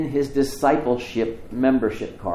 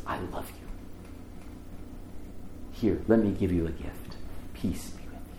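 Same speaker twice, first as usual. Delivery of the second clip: hushed, tender voice of Jesus; soft whisper; 5 semitones lower, 10 dB quieter than usual. Acted. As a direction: breathe the words gently, almost whispered. Jesus voiced speaking to disciples.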